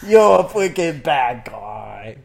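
slow, loud goofy judge; drawn-out mock verdict; Discord moderator voice. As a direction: condemn him slowly in a silly accent. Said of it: "sentencing John Wayne Gacy in The Voice".

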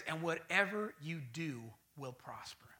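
slow, reverent whisper; whispered scripture. Acted. Preacher whispering the verse to the church. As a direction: breathe the promise out softly.

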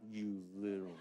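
dad mock-fuming at daughters; muttered exasperated laugh. Dad's exasperated reaction.

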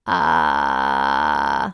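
low creaky fry sound; vocal fry. Shown as a demonstration. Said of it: "The first exercise sounds like this".

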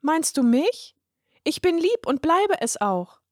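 put-on high little girl's voice, a bit unsure; little girl, high and hesitant. A narrator's reading. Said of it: "entgegnete verunsichert".

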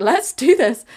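loud, high, rousing echo; match his cry with hammed-up zeal; gung-ho agreement. Customer echoing the repairman's go-ahead, gung-ho. Customer echoes him eagerly.

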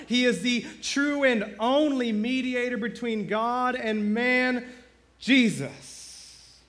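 building to a loud, high climax; preaching climax. Real, not acted. Pastor driving home the point to the church, building to full force. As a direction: preach it to the rafters, rising.

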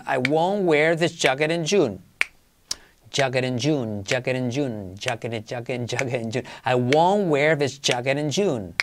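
quiet, exaggerated "jacket" and "June"; exaggerated practice. Shown as a demonstration. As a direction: overdo the j sounds. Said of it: "Exagerarlo todo lo que queráis". (exagerando "jacket in June")

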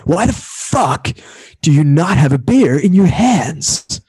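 mock-outraged party dude, words stretched out slow; rowdy surfer dude. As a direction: imitate an over-the-top surfer bro. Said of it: "That dude sounded like that".

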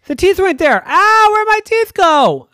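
high, loud, alarmed cry; daddy finding the glass empty, alarmed; alarmed surprise. Acted. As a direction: panic at the missing teeth. Daddy finds teeth gone.

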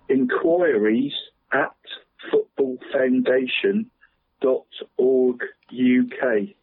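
each part of the address spaced out slowly; careful, drawn-out dictation pace. Real, not acted. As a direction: read the web address slowly so people can note it. Reading out a web address.

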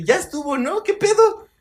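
high, exasperated protest; mock fed-up teen. Exasperated quote to the lady.

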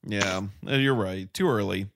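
usual speaking voice

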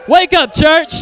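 loud wake-up shout; calling out. Real, not acted. Preacher rousing a sleepy church, calling out.

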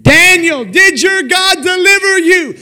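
high, loud shout down into a den; calling down. Acted. King shouting down to Daniel in the den, calling out. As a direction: holler it down to someone far below. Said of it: "he called down into the den".

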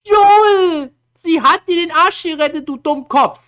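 high, loud mock outburst; exasperated scolding. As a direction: yell the name, then scold him. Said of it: "Wo man sich so denkt".

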